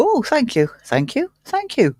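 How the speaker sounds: high, sing-song thanks; mock gratitude. Acting out greeting each Twitter notification, mock grateful.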